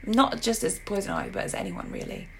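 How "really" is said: ordinary talk, nothing marked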